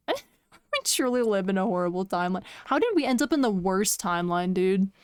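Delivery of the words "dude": ordinary delivery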